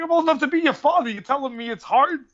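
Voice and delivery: high, incredulous retort; mock indignant. Older fighter retelling his retort to younger one, mock indignant. Acted scolding of younger sparring partner.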